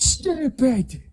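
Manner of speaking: high, exaggerated British "Stupid"; hammed-up British "Stupid"; mock British accent. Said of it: "You ever heard a British person say stupid?".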